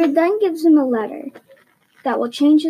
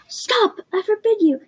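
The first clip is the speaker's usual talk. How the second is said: high, panicked cry of protest; panicked yell; 8 semitones higher than usual. A narrator's reading. Uncle Vernon stops Hagrid telling Harry, panicked high yell. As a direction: shriek it out to stop them in a panic. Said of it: "yelled Uncle Vernon in panic".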